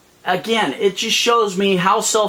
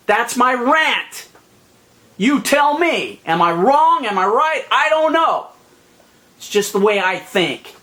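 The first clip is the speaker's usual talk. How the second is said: ordinary talk, nothing marked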